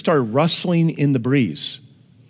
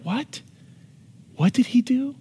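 stunned Bible reader; 9 semitones higher, 3 dB quieter than usual. Retold or imitated. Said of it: "you're like".

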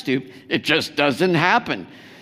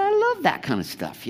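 much higher, sing-song mock enthusiasm; high mock gushing; 14 semitones higher than usual. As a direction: gush like it's a fairy tale. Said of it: "I said".

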